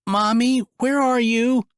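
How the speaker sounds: put-on high young child voice calling out; a kid lost in the dark; child calling for mommy; little boy calling. A narrator's reading.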